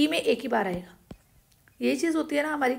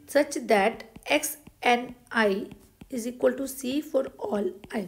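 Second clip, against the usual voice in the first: slow dictation; much slower than usual. Shown as a demonstration. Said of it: "dictating theorem slowly".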